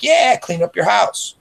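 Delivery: drawn-out punchline nudge; teasing urge. Retold or imitated. Punchline urging cleanup.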